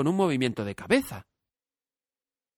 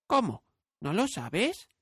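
put-on much higher, astonished child; surprised girl; 9 semitones higher, slower than usual. A narrator's reading. Dorothy is amazed the scarecrow doesn't know. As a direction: gasp it in disbelief.

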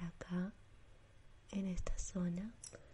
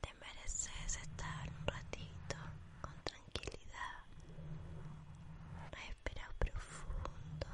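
sleep story narrator; 3 semitones lower than usual. Acted. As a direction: lull them to sleep.

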